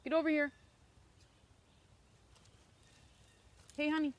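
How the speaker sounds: loud, high call across the woods. Real, not acted. Owner calling her dog from a distance, loud and high.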